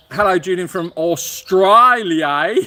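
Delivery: loud, stretched-out Australian twang; mock Australian accent. Host greets a viewer from Australia, mock Australian accent.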